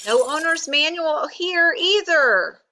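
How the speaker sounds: high exasperated cry; frustrated shout. A narrator's reading. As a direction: call out in mounting frustration. Rabbit still searching, shouting.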